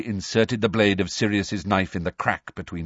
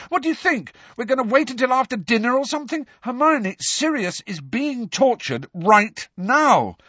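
angry; 14 semitones higher than usual. A narrator's reading. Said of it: "said Harry angrily".

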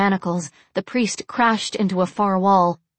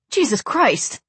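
higher, startled oath; shocked blurt; 4 semitones higher than usual. A narrator's reading. Alex watches the men ignite, blurting in shock. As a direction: blurt it out, aghast. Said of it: "Alex blurted".

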